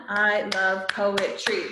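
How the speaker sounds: slow, each syllable spaced; slow syllable count. Shown as a demonstration. Teacher to class, counting syllables slowly.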